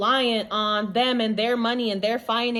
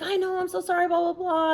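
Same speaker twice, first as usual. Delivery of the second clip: husband's apology; 8 semitones higher, 4 dB quieter than usual. Retold or imitated. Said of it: "he's like".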